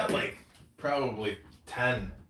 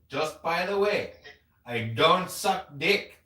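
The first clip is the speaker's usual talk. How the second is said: loud, higher, exaggerated Indian accent; projected Indian accent; 6 semitones higher, 8 dB louder, slower than usual. Imitating an Indian dentist date turning him down, projected Indian accent. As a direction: project a mock Indian accent, slow and emphatic. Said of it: "in that accent? She's got an accent, yeah. But, I mean, I'm really projecting it".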